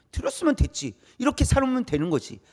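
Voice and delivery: dismissive imitation. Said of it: "라고 익숙해진다는 거예요".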